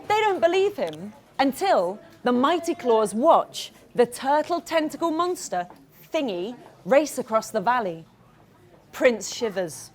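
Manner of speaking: ordinary delivery, nothing marked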